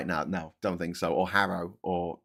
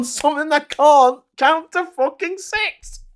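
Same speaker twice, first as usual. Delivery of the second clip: outraged rant; 14 semitones higher, 7 dB louder than usual. Real, not acted. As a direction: hammer home how absurd it is. Ranting about chatbot's wrong list.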